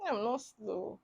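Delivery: very soft, said to yourself; inward retort. Retold or imitated. Student answering the lecturer in her mind, very quiet. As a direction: keep it under your breath, not for her to hear. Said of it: "I'm not saying it out for her to hear".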